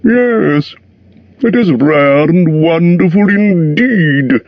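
slow, preening dragon voice; absurdly pleased dragon. A narrator's reading. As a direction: purr with vanity. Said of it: "absurdly pleased".

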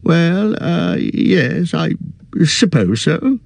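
put-on a flustered old hobbit caught out; stammering. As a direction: stumble over the words. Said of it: "stammered Bilbo".

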